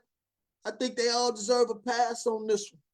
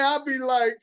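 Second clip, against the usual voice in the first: ordinary delivery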